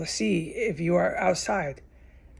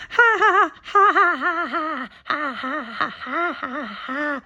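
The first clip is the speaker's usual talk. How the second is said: mock their wild laughter; mock hollering laugh; 8 semitones higher, slower than usual. Imitating the neighbors hollering and laughing, high mocking cackle. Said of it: "They're hollering and laughing".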